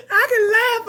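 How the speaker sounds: high, loud laughing cry of love; laughing shout. Real, not acted. Daughter calling out love to her mom, laughing loud.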